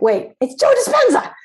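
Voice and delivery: can't believe the no, pitch shoots up; imitating her own outraged surprise; indignant disbelief. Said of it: "And I'm like".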